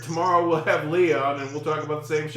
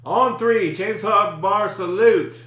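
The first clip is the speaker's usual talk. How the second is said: loud countdown; 4 semitones higher, 6 dB louder, much slower than usual. Real, not acted. Counting to group salute.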